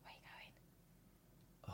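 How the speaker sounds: slow hushed whisper. Real, not acted. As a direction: whisper after the cat. Whispering to the cat.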